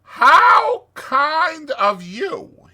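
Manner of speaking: high, drawn-out joyful shout; exuberant. Acted. Toad dances round his guests, exuberant. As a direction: gush it out very loud.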